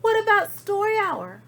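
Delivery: high, loud pleading; eager kids. Acted. Kids asking for story hour, high and loud. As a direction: beg it happily. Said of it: "cried the kids".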